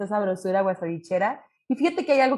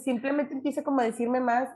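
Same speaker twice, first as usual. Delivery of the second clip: ordinary talk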